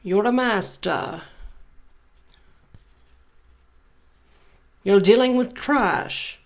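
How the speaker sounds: mock dramatic pronouncement. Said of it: "I'm just kidding".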